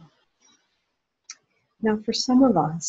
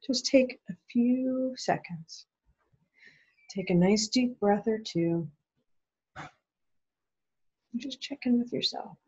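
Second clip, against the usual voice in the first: slow, softer guided check-in; guided pause; 4 semitones higher, 4 dB quieter, slower than usual. Acted. Speaker guides listeners through a pause, slow and soft. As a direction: ease the webinar audience into a calm pause. Leads a short mindful pause.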